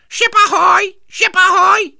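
very high, loud parrot screech; parrot squawk. A narrator's reading. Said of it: "he squawked".

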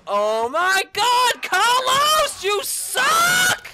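rising to a high, loud shout; furious yell. Real, not acted. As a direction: blow up at Carlos. Yelling at Carlos's failure.

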